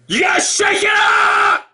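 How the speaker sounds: sudden high exclamation; shout. Pretend. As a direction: yell it like a wake-up jolt. Repeats his wake-up call.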